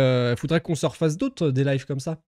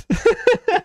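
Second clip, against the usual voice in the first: short, high-pitched burst of giggles; 4 dB louder than usual. Real, not acted. Streamer struggling to answer in English, giggling. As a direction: crack up mid-sentence.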